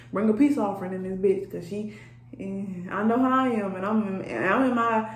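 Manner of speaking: ordinary delivery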